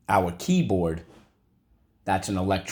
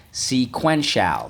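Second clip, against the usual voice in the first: slow syllable drill; 4 semitones higher, 4 dB louder, slower than usual. Shown as a demonstration. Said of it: "So let's go".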